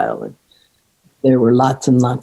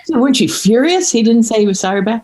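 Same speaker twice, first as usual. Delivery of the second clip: higher, faster disbelieving friend; pitched-up mock outrage at the missing sorry; incredulous friend; 9 semitones higher than usual. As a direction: play the friend who can't believe there was no apology. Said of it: "she said".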